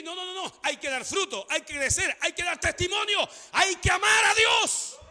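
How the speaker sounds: climbing list, ending in a shout; building exhortation. Real, not acted. Preaches at full force.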